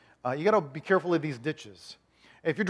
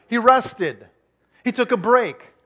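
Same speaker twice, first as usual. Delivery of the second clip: higher and louder, driving the point; emphatic preaching; 5 semitones higher, 6 dB louder, slower than usual. Acted. Pastor preaching the seventh day, emphatic. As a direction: punch it to the congregation.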